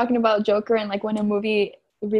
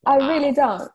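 put-on higher, louder playful British accent; 5 semitones higher, 5 dB louder than usual. Friend playing modest about the accent, British accent.

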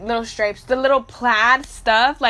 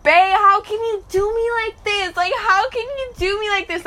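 mock heartbroken; 7 semitones higher than usual. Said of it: "I was just like".

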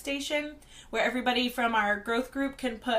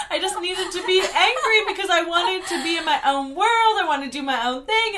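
higher, loud, laughing protest; laughing self-justification; 5 semitones higher, 6 dB louder than usual. Retold or imitated. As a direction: plead your case loudly through laughter. Mock justification of outburst, laughing.

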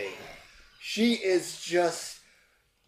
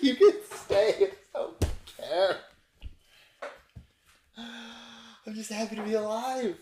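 put-on higher, drunk and carefree; tipsy carefree; 7 semitones higher than usual. GM voicing the drunk Hope welcoming the stranger. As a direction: loose and giddy, barely caring. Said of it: "She's like".

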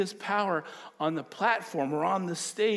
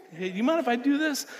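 playful imagined ask; 9 semitones higher, 5 dB louder than usual. Retold or imitated. Imagined polite question from Jesus.

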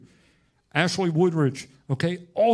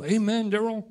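lightly hammed-up pat on his own back; mock self-praise; 6 semitones higher than usual. A preacher congratulating himself in the mirror, hammed-up. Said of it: "preach this in the mirror and then go".

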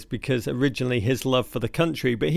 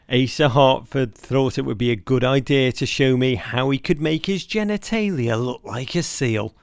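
ordinary talk

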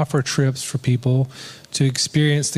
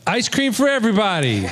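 higher, laughing mock proclamation; laughing announcement; 7 semitones higher than usual. Pastor retelling his ice cream shout to the team, laughing. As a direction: announce the treat while laughing.

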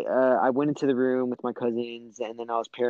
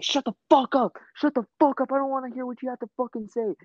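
much higher, retold furious shriek; aunt screaming; 12 semitones higher, faster than usual. Aunt screaming at niece to shut up, very high furious shriek. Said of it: "going off on me".

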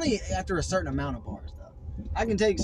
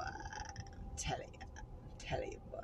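soft unvoiced whisper, drawn out slowly; whispered catchphrase; 17 dB quieter than usual. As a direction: breathe it out slowly, barely voiced.

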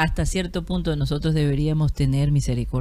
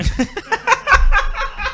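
real laugh bursting out high; high burst of laughter. Real, not acted. Laughing at bambuco in discotheques.

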